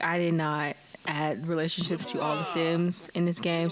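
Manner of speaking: ordinary talk, nothing marked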